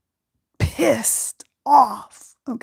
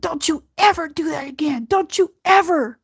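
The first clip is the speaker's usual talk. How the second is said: high, pointed warning hitting ever; a mother warning never again; stern warning; 6 semitones higher than usual. Retold or imitated. Mother warning voiced in story.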